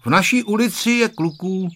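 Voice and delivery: put-on high, ready reply from a boy; unhesitating child's answer. A narrator's reading. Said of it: "odpověděl bez rozmyšlení Honzík".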